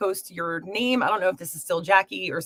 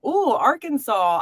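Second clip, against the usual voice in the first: delighted ooh; 8 semitones higher, 3 dB louder, slower than usual. Real, not acted. Reacts to viewer's location.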